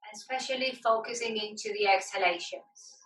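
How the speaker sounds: measured relaxation-class voice, leaving space to breathe; guided meditation. Acted. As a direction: guide them inward, phrase by phrase, unhurried. Leads yoga meditation.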